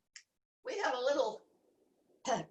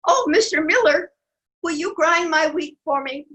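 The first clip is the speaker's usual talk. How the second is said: louder eager pleading; eager hen; 6 semitones higher, 7 dB louder than usual. Acted. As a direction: call up sweetly to the miller. Hen asks the miller.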